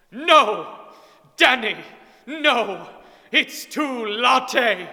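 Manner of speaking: high, loud shrieking plea; panicked scream. Acted. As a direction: scream it in panic, stretching the last words. Girlfriend refuses abortion, screaming.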